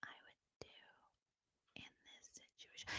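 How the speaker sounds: barely audible, word-by-word whisper; mocking an ultra-slow, faint whisperer. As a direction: whisper with long gaps between words. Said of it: "her whisper is like this".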